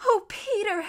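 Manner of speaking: put-on high, loud girlish cry; eager high-pitched outburst; excited exclamation. A narrator's reading. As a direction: exclaim with breath-catching wonder. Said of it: "exclaimed Lucy".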